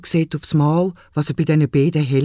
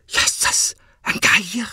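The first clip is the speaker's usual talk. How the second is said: frightened cry; 6 semitones higher, slower than usual. Acted. Boy sees a vulture.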